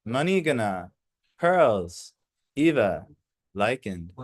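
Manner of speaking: measured, formal verse reading; slow devotional recitation; scripture reading. Acted. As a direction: read the holy verse with weight. Reads verse and translation aloud.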